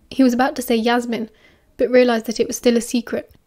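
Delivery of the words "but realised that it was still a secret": ordinary delivery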